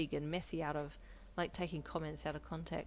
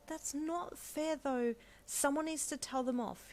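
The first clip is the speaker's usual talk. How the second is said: angry; 9 semitones higher, slower than usual. A narrator's reading. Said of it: "he replied angrily".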